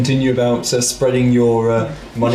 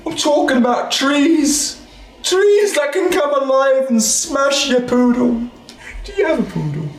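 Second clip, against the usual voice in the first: high slow NPC voice; 11 semitones higher than usual. Acted.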